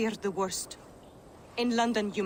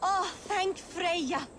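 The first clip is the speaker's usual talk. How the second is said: relief; 9 semitones higher, much slower than usual. Acted. Relief after hearing not her daughter.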